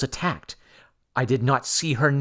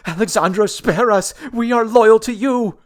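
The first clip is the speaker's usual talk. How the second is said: high, loud desperate begging; terrified pleading; 11 semitones higher, 7 dB louder than usual. A narrator's reading. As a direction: cringe and beg for mercy. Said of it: "terrified nobles ... fell cringing".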